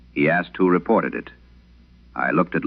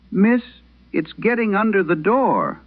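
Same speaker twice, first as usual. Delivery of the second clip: put-on much higher, scared little boy's voice; frightened crying boy. Trapped boy begging the night operator for help, high frightened child voice. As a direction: plead like a terrified small child, pitched well up. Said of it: "The boy was already crying".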